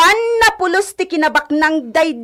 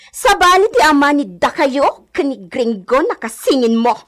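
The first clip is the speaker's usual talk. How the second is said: usual speaking voice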